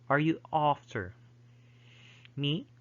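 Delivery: touch of British accent. Shown as a demonstration. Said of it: "So something like that".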